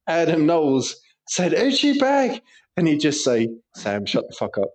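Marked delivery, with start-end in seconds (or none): 1.5-2.4 retold shout. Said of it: "I used to shout". 3.7-4.7 low, quiet, weary retort; fed-up flatmate. Retold or imitated. Fed-up flatmate's reply acted.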